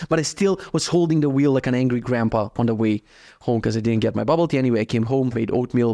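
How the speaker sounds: ordinary talk, nothing marked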